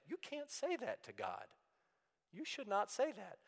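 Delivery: high, scandalized protest; shocked inner voice. Retold or imitated. Teacher voicing a reader's shock at the psalm, high and scandalized. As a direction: gasp it like someone appalled at a prayer. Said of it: "sometimes you think".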